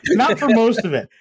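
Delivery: ordinary talk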